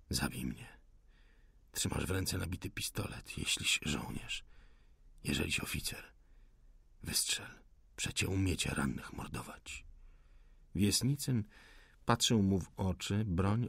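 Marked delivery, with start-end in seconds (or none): 0.1-9.9 faint, hushed, dying defiance; weak wounded rebel. Acted. The wounded rebel dares the officer to shoot, barely whispering. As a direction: whisper your challenge through the pain.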